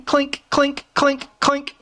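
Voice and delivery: high tinny clink clink, like ice in a glass; imitating glass clinks. To the audience, imitating his glass clinking on the mic. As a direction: mimic the glass tapping, light and high. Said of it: "my glass is going".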